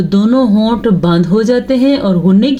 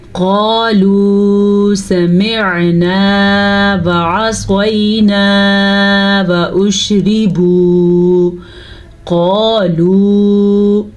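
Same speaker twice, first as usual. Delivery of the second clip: slow melodic tajweed recitation; Quran recitation; much slower than usual. Shown as a demonstration. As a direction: draw the vowels out like a Quran reciter. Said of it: "teacher recites the verse to teach".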